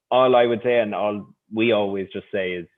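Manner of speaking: normal talking voice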